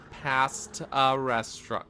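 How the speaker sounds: "past a restaurant" slow, with loud "past a" run together like pasta; punched pun. Shown as a demonstration. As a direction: lean hard and slowly on "past a".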